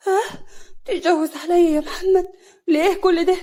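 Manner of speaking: high, loud wounded wail; acted sobbing disbelief; hurt and tearful. A narrator's reading. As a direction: protest as if about to cry. Husband threatens a second wife.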